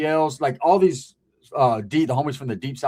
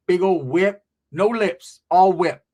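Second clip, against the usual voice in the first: usual speaking voice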